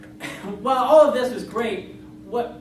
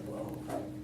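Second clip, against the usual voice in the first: soft breathy whisper; whispered whoa; 13 dB quieter than usual. Retold or imitated. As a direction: breathe it out in awe. Surprised whispered reaction.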